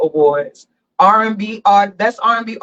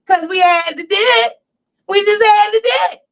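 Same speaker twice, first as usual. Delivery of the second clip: hyped catchphrase; 13 semitones higher than usual. Real, not acted. Show catchphrase, hyped intro.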